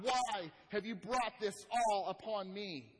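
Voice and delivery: loud, slow, drawn-out cry to God; Job crying out. Acted. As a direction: raise the voice and stretch out the anguished question. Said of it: "he cries out to God".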